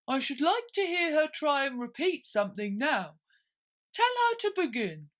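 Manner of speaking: high, loud creature voice; high loud pompous turtle; Mock Turtle voice. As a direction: turtle giving orders.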